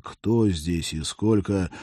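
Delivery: ordinary delivery, nothing marked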